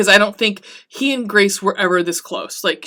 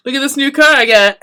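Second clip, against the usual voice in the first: brash, fast, higher and louder car bragging; proud show-off; 6 semitones higher, 6 dB louder, faster than usual. Retold or imitated. Lestat shows off his new car to Claudia and Louis, brash and bragging. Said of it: "and he's like".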